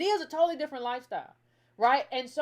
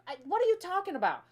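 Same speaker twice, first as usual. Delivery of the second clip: ordinary talk, nothing marked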